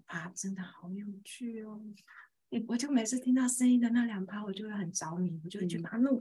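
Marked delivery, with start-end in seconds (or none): 0.0-1.8 whispering. Real, not acted. Fascinated aside.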